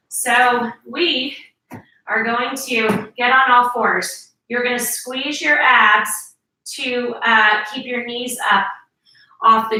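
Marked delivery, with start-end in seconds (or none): none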